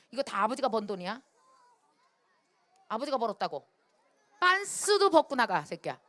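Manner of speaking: angry father. Retold or imitated. Professor father throwing his son out, angry mock yell.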